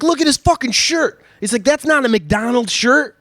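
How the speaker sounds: high, incredulous cop voice; mock agent squabble. Agents arguing about the suspect's shirt. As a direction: bicker like frustrated agents.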